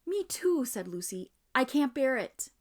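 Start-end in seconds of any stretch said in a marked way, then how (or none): 0.1-0.6 frightened. Acted. Lucy also being dragged. 1.6-2.5 distressed. Acted. Lucy being dragged.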